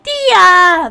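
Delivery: loud mock call of a name; shouting a name. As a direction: yell the name after someone. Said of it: "running off being like".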